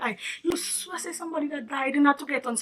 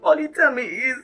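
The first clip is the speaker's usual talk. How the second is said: tearful, breaking voice; crying role play; 4 semitones higher than usual. Retold or imitated. Telling Tamara through tears what the agent did, crying. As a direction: let the words break into sobs. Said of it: "a role play there".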